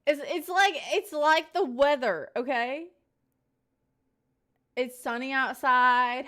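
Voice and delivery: loud, high, bright announcing; big, playful storyteller energy; excited. Acted. Animated weather comparison.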